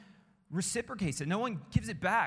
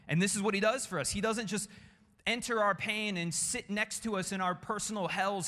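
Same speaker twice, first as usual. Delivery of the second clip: ordinary talk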